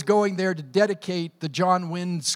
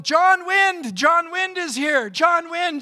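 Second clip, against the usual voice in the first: high, loud joyful calling of the name; crowd yelling; 11 semitones higher, 5 dB louder, slower than usual. Retold or imitated. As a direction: shout it out like villagers greeting a hero. Villagers yelling the name in welcome.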